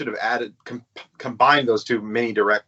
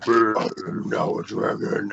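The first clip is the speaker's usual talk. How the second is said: generic monster voice; 3 semitones lower than usual. Retold or imitated. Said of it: "generic monster voice".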